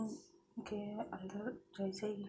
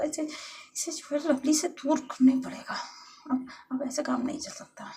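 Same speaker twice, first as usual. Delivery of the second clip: loud, high, worked-up monologue; agitated scheming; 5 semitones higher, 9 dB louder than usual. Acted. Jealous lover resolving to separate her rival from Lovely, agitated. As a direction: reason urgently with yourself, voice raised.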